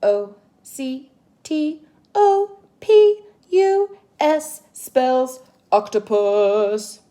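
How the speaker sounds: slow, loud letter by letter spelling; drawn-out spelling for children. Shown as a demonstration. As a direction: spell each letter out slowly and clearly. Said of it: "likes to spell it first".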